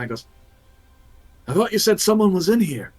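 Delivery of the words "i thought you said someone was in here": higher, quick in-character challenge; questioning guard. Acted. Arriving creature asks the eye about intruders, high quick challenge. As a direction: voice a creature querying its lookout, pitched up and fast.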